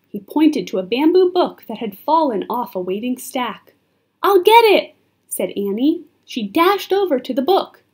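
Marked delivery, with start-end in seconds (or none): 4.2-4.9 high, loud, urgent volunteering; eager call. A narrator's reading. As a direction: call out as you rush off. Annie eagerly volunteers amid danger.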